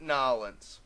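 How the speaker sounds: Nolans as NAH-lunz; New Orleans squashed into two syllables, Nolans; joking Nolans. Shown as a demonstration. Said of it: "I'm joking around when I talk about".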